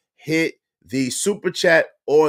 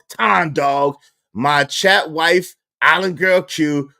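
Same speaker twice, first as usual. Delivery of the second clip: usual speaking voice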